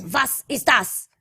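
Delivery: mock German accent, slow and stiff. Friends trying accents, a slow mock German. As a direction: play at a gruff German, slowly. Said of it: "German are kinda weird".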